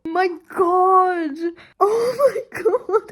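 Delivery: loud sobbing disbelief; tearful shock. Real, not acted. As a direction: gasp it out overwhelmed. Shocked crying reaction.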